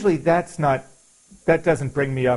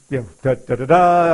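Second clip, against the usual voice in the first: mock fanfare; 7 dB louder than usual. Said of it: "I'm Superman".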